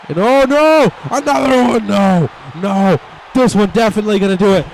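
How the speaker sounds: high, loud frantic call; excited shout. Real, not acted. Commentator reacting to another slam, shouting. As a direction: yell it as the slam lands.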